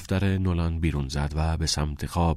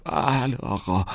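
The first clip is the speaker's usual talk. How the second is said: slow, higher, strangled voice; choked with pain; 4 semitones higher, slower than usual. A narrator's reading. Beaten pupil answering the principal, choked with pain. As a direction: squeeze it out slowly through the pain. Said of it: "با صدایی خفه از شدت درد".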